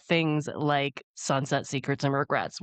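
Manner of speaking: usual speaking voice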